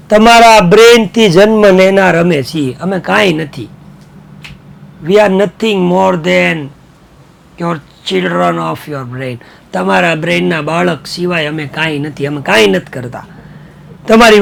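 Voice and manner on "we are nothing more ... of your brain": slow dictation reading. Shown as a demonstration. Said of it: "teacher reads English line very slowly".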